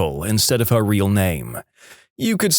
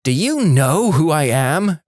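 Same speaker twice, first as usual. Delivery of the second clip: slow haughty entitled demand; entitled diva; 4 semitones higher, slower than usual. Retold or imitated. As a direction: act like a self-important diva. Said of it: "had the unmitigated gall to do the".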